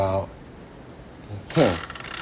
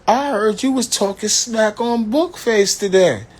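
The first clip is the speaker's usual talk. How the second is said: high mama impression; 11 semitones higher than usual. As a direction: squeak it up high like your mama catching you out. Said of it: "This how she said".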